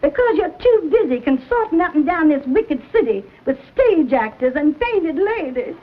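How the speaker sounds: normal talking voice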